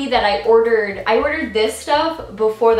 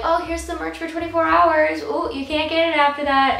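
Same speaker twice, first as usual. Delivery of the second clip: higher-pitched teasing sales voice; mock merch seller; 6 semitones higher than usual. Mimicking the merch sellers to viewers, teasing. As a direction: imitate a teasing merch announcement.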